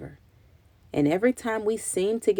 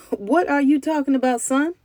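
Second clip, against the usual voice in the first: high, louder amused question; amused granddaddy; 7 semitones higher, 4 dB louder than usual. A narrator's reading. Granddaddy amused at the boy's fair idea, chuckling. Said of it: "He laughed and said".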